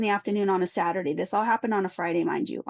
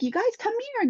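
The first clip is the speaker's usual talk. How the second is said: high call across a field. Retold or imitated. Mother calling her children across the field, high call. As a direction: call the kids back.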